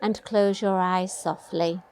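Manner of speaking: ordinary delivery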